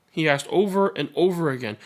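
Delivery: gentle read-aloud storytelling voice; story narration. A narrator's reading. Narrator reading a picture book to listeners, gentle storytelling.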